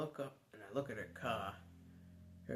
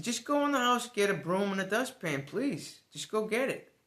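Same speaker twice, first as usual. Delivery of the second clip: higher, louder urgent pleading; urgent insisting; 8 semitones higher, 7 dB louder than usual. Retold or imitated. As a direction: push her to hurry, firm but begging. Urging panicked girlfriend to hurry.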